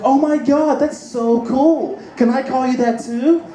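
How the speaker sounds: high, slow gushing lady voice. Retold or imitated. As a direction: swoon over it. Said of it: "She's like".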